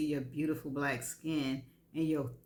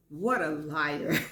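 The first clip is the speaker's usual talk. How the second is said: ordinary delivery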